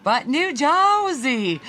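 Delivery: put-on high, drawn-out New Jersey accent; exaggerated New Jersey accent. Co-host having another go, hammed-up New Jersey accent. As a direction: swoop up and stretch it, mock New Jersey. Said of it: "can't pick up on the accent".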